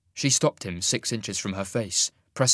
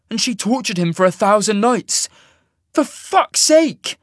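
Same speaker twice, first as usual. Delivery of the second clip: loud, high-pitched anguished protest; hurt, angry outburst; 11 semitones higher, 8 dB louder than usual. A narrator's reading. Rejected man protesting their shared past, in a hurt, angry shout.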